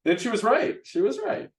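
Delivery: high, excited repeat of the praise; delighted. Real, not acted. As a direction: gush proudly about the player. Delighted by player's advice.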